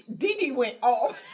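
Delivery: ordinary talk, nothing marked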